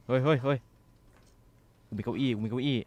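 high loud panicked yell; frantic warning yell. Real, not acted. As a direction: shout to scare it off. Monster appears.

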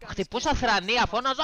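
half-shouting the memory of the fit; retold yelling. (φωνακτή, αργή αφήγηση)

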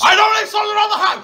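high-pitched shouted protest; indignant denial. Real, not acted. Denying a mate's accusation about snobs, outraged shout. Denies accusation in prank row.

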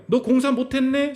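mock the failure in a sing-song; mocking sneer. Preacher voicing scoffers who sneer at a failed side business. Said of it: "사람들이 비웃는다는 거예요".

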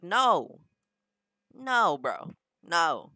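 high, loud retold "no"; acted-out firm objection; emphatic refusal. Retold refusal to press the button.

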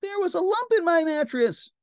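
very high princess whine; high-pitched storybook grievance; complaining princess. A narrator's reading. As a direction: wail the complaint high and steady. Princess complains of bad night.

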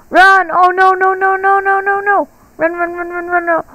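high panicked cries of no; panicked yelling. Real, not acted. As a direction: yell in a frightened rush. Chased by the seeker in game.

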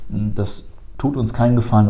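usual speaking voice

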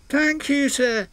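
an engine thanking his boss with joy; happy thanks. Acted. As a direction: beam with gratitude. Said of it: "said Henry happily".

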